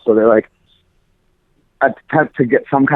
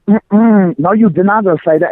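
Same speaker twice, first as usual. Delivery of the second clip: higher, sassy imitation of a furious clerk; imitating the clerk snapping at a coworker; mock Long Island Puerto Rican; 7 semitones higher than usual. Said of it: "all fucking Long Island Puerto Rican, like, furious".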